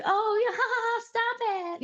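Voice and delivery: high, coy fake-modest giggle; high-pitched giggly fake modesty; mock bashful giggle. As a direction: play the flattered girl brushing off a compliment. Mock reaction to old man's compliment.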